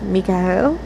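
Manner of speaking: a faltering, hesitant name; stuttering gulp. A narrator's reading. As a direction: gulp it out, half-stuck in the throat. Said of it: "you stuttered as you gulped bitterly".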